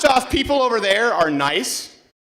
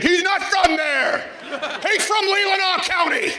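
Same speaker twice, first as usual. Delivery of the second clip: high mocking shout; mock correction; 8 semitones higher than usual. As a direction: imitate the guy setting the record straight. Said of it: "he's like".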